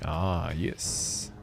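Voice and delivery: ordinary talk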